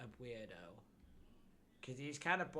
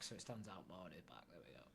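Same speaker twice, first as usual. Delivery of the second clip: whispering; 5 semitones lower, 9 dB quieter than usual. Real, not acted. Quietly deciding aside.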